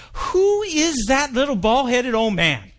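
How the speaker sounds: high, slow, indignant question; mad demand. Acted. Pastor asks the lay leader, heated and slow. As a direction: demand to know who did this. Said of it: "Now the new pastor's mad".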